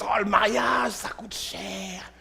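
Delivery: slow, soft whisper of a lame excuse; mock excuse. Preacher mimics couples dodging marriage, slow whispered excuse. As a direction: mumble the excuse half-ashamed, slowly.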